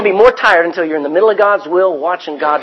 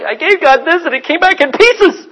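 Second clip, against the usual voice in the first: mock complaint; 9 semitones higher than usual. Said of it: "and it's like".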